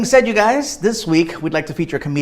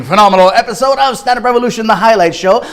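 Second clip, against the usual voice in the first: loud, high-energy show opener; booming hosted-show welcome; show host announcer; 5 semitones higher, 10 dB louder than usual. Acted.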